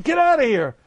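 a pretend outburst against an intrusive image; shooing thoughts away. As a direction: drive the thought out with a high yell.

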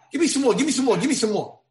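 high, loud greedy pleading; eager demand. Acted.

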